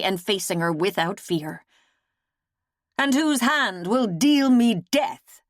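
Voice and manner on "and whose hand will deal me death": higher and louder, heated; hot question. A narrator's reading. Lady challenging the seer, hotly. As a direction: demand who will kill her. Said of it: "she questioned hotly".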